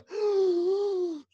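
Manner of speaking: sharp high panicked gasp. Retold or imitated. Reliving the anxiety attack in the car, sharp gasp. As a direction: gasp for air as if welling up with tears. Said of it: "I'd be like".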